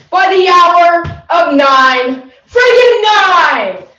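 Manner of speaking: shouted high and loud, slow; angry line reading. Acted. Actor reads Romeo's romantic line as an angry shout. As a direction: bark the line at her furiously. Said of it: "angry vibes from you".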